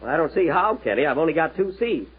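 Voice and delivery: normal talking voice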